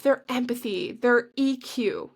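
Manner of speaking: normal talking voice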